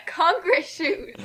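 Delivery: slow words through a laugh; cracking up at the ridiculous phrase. Real, not acted. Laughing at the fanfic's shoe description, repeating it slowly.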